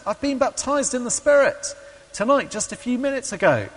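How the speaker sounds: high, elated announcement; retold joyful excitement. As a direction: imitate a convert bursting with news. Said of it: "filled me with joy and happiness".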